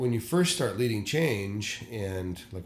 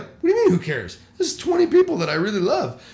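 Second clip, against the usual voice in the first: much higher and louder, incredulous protest; indignant protest; 11 semitones higher, 10 dB louder than usual. Retold or imitated. Young pastor protesting to his mentor, loud incredulous objection. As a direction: protest as if stung by the remark.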